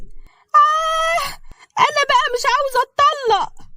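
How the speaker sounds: put-on a sly young wife stretching out her refusal; coy wife. Mai interrupting her husband and father-in-law, coy and high-pitched.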